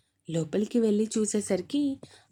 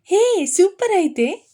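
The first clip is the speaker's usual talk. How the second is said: excited cheer; 9 semitones higher, 7 dB louder, slower than usual. Acted. Excited cheer at good news.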